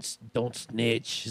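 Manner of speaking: slow, drawn-out warning; slow mock street warning. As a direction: lean on it like street law. Neighborhood code voiced.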